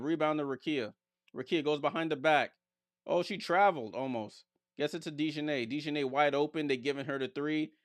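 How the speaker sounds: quick, lively basketball commentary; animated game call with rising peaks; live play-by-play. Real, not acted. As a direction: follow the ball pass by pass.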